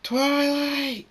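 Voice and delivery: very high, loud, stretched-out name; terrified scream. A narrator's reading. Spike screams to Twilight in her memory, terrified scream. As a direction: scream for help in terror. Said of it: "Spike screams".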